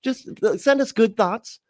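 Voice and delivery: high, pleading squeaky voice begging you to take it; mock desperate seller. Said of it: "The one where they're like".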